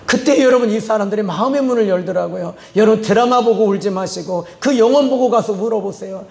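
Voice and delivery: usual speaking voice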